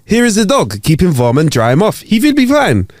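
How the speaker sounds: put-on high-pitched joke German accent; hammed-up German accent, pitch jumping up. German tourist handing back a rescued dog, German accent. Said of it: "Sorry about the German accent".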